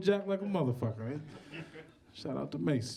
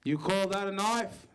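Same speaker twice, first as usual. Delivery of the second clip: bad Australian accent. MC retrying the accent for the crowd, mock Australian. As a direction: have a clumsy go at an Aussie accent. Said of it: "I'm gonna try it one more time".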